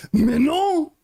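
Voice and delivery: very high mock-French protest; silly French intonation. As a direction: imitate a comically dismayed French guy.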